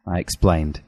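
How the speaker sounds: usual speaking voice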